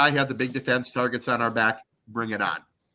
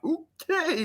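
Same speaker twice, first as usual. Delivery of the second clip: high, small-voiced mock compliance; cowed okay. Broadcaster answering the coach's threat, meekly high-pitched. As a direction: squeak out a meek agreement.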